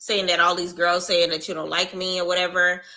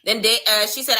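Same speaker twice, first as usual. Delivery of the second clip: normal talking voice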